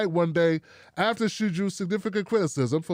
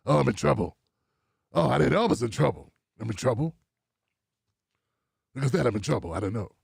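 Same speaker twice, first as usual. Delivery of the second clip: mock panicked apology; 6 semitones lower than usual. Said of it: "she apologized like".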